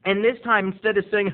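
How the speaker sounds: ordinary talk, nothing marked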